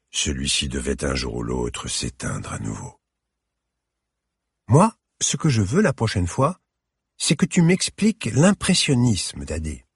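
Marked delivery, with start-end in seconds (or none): 4.7-9.9 put-on little girl. A narrator's reading. Little girl asks grandfather.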